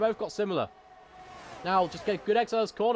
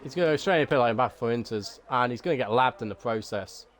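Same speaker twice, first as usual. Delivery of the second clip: subdued monotone; 8 semitones lower than usual. Pretend. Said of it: "I have to dampen my enthusiasm".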